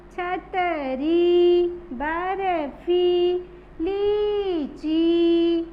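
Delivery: दीपक, मछली, छतरी and the rest slowly, each ee held; word list with every long ee stretched and pushed; long stressed ee. Shown as a demonstration. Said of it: "लंबा sound लेके बोलना है". (हर शब्द की बड़ी ई लंबी, जोर से)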